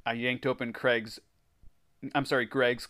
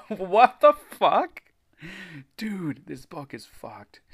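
laughing disbelief; 6 semitones higher, 4 dB louder, slower than usual. Real, not acted. Reacting to gross story.

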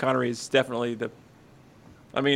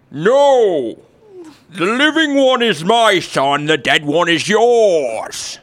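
loud, slow, higher British woman's insistence; overdramatic woman; 4 semitones higher, 6 dB louder, much slower than usual. Acted. The second mother contradicting the first, overdramatically. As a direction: insist with theatrical outrage. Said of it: "That's a little overdramatic".

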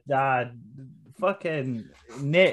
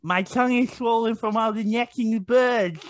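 high, thick-tongued lisping voice; Mike Tyson; 7 semitones higher than usual. Pretend. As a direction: talk round a swollen tongue, pitched up. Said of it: "a little bit like Mike Tyson".

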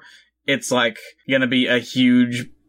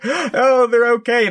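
1 high relieved gasp; 10 semitones higher, 3 dB louder, slower than usual. Retold or imitated. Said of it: "he's like".

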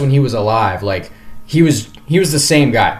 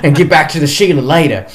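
imitating Steve in a weak Australian accent, a bit higher; poor Australian accent; 6 semitones higher than usual. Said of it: "I'm sorry for my poor accent".

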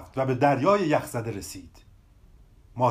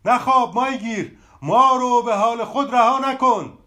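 higher, louder call to the sleeping fisherman; tree shouting; 6 semitones higher, 4 dB louder than usual. A narrator's reading. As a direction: shout it out to wake him. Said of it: "درخت فریاد زد".

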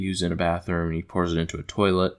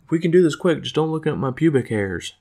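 raised character voice, insistent; put-on farm girl; 7 semitones higher than usual. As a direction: imitate a farm girl giving orders. Voices farm girl Bertha, retelling.

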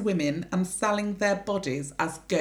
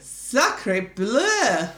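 put-on French accent; 2 semitones higher, 7 dB louder than usual. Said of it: "C'est delicious".

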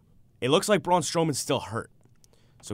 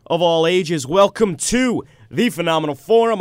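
ringmaster; 6 semitones higher, 11 dB louder than usual. Acted. Podcast host opening announcement.

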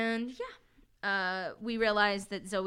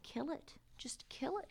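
soft, high, sinister mock whisper; tempting whisper; 6 semitones higher, 11 dB quieter than usual. Voice in Thalia's head urging her to kill, whispered.

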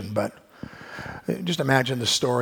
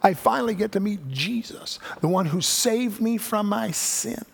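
whooping much higher, then gushing; joyful believer; 10 semitones higher than usual. Pretend. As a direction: burst out in delight at meeting Jesus. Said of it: "it'll be like".

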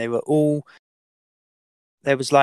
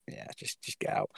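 whispered get out, almost no voice; hushed brush-off; 13 dB quieter than usual. Retold or imitated. Colleague whispering to him to just leave quietly. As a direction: quietly wave him off under your breath.

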